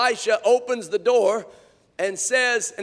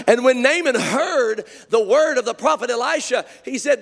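usual speaking voice

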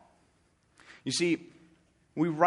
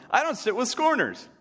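proudly proclaimed, much higher and louder; loud announcement; 10 semitones higher, 4 dB louder than usual. Retold or imitated. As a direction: announce it to the whole office. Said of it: "And he announces to everyone".

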